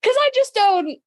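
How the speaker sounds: sudden high burst of excitement; excited outburst. Real, not acted. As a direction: blurt it out, really worked up. Excited outburst about fic ratings.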